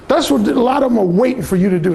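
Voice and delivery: ordinary delivery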